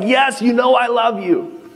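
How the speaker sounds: high, slow imitated insistence; Peter. Preacher voices Peter answering Jesus on the shore, insistent. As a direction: stretch it out high, insisting on love. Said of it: "Peter's the one that's like".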